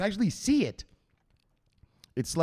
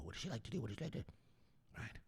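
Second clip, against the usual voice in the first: much softer, secretive question; hushed asking around; 2 semitones lower, 22 dB quieter, much faster than usual. Retold or imitated. Asking her friends about her, hushed and much softer. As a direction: ask her friends on the quiet. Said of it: "You ask people".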